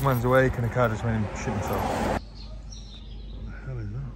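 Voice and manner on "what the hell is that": normal talking voice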